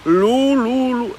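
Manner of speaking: high, silly lilting vowels; mock singsong voice. Grandmother mimicking her video voice, high mocking lilt. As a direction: mimic a put-on voice, high and drawn out. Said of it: "Why was your voice like".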